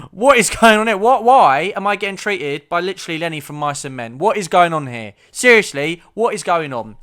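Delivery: loud, high-pitched incredulous rant; exasperated outburst. Acted. Patient ranting about being treated by Lenny, exasperated outburst. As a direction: shout it in disbelief at the absurdity.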